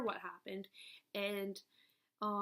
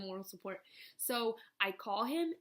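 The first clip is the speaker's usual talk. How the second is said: usual speaking voice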